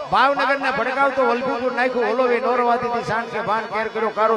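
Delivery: chanted verse building from steady to loud and high; folk poem recitation. Acted. Storyteller reciting the quake poem to the dayro crowd, rising chant.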